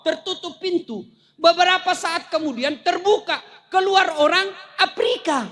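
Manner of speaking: ordinary delivery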